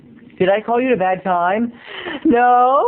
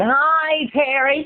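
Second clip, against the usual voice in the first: high mock phone-message voice; sing-song it high like a voicemail; imitating Laura; 8 semitones higher, much slower than usual. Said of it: "a message on my answering machine".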